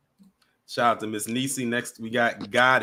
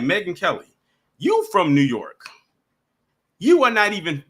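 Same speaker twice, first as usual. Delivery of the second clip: higher, emphatic retold challenge; indignant telling-off; 6 semitones higher, 4 dB louder than usual. Host retelling a rant at Megyn Kelly, indignant. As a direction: call her out, punching each phrase louder as it builds.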